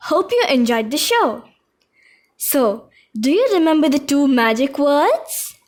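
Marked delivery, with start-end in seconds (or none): none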